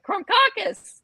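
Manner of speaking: put-on high excited kid voice shouting Krumkakis. Cartoon kids cheer the pastry in a high excited voice. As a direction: yell it like an eager little kid. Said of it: "they're all like".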